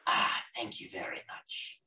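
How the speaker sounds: hushed polite thanks; whispery shopkeeper voice. Acted. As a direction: breathe it like a discreet merchant.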